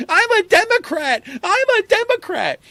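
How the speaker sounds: very high, loud mock protest; Nancy Pelosi. Politician pleading with angry activists, high mock shouting. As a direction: squeal it out as a hammed-up chant. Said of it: "And then she's like".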